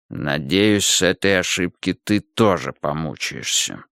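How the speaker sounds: low, slow ogre voice; weary, weighty low reply; resentful Ogre. A narrator's reading. Wounded Ogre answers Milos, low and resentful.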